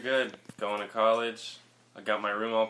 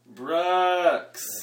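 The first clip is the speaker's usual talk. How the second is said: loud, higher, drawn-out yell; yelled name; 4 semitones higher, 5 dB louder than usual. Real, not acted. Guest told to say it louder, yelling his name. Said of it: "Don't yell".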